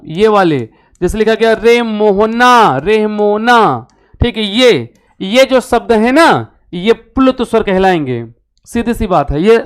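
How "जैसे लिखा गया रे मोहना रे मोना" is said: stretching रे Mohana slowly; sing-song call, रे held long; drawn-out calling. Shown as a demonstration. Teacher drawing out रे Mohana as a call. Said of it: "तीन गुना समय लगे".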